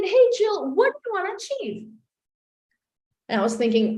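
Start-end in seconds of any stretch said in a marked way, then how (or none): 0.0-1.9 high, bright-pitched coach greeting; acted high-pitched, eager question; perky coach. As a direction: chirp it like an upbeat life coach. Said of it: "my coach said".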